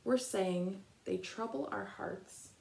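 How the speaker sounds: gentle read-aloud picture book voice, long pauses between verses; reading to children. Acted.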